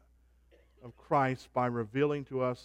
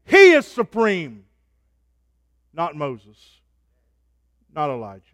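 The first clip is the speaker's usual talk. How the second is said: preaching at full force; 13 dB louder than usual. Real, not acted. Preacher declaring the Godhead to the congregation, full force. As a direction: proclaim each word.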